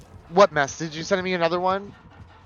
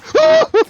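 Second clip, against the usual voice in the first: very high loud startled gasp; sharp gasp; 12 dB louder than usual. Real, not acted. Noticing the generator with a sharp high gasp. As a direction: gasp in shock.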